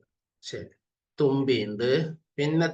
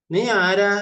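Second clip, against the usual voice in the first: loud reading; 7 semitones higher, 7 dB louder, slower than usual. Shown as a demonstration. Reading earthworm line aloud.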